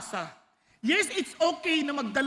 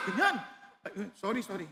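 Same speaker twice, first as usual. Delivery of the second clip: ordinary delivery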